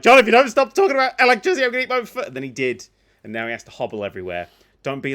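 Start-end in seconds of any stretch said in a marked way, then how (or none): 0.0-2.3 exasperated threat. Retold or imitated. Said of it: "He was like".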